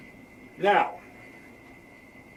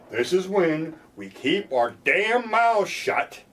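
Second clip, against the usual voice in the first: slow emphasis; 5 semitones higher, much slower than usual. Real, not acted. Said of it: "emphatic slow advice".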